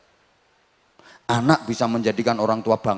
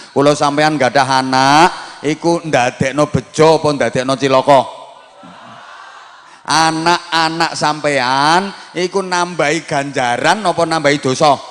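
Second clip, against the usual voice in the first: fiery preaching; 5 semitones higher, 7 dB louder than usual. Acted. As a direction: build the questions to a ringing climax. Preaching at full force.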